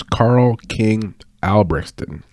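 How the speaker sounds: slow, syllable by syllable on Albrechtsson; unhurried fighter intro; careful slow naming. Shown as a demonstration. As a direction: spell out the Swedish name with each sound. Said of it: "I always have to say it slowly".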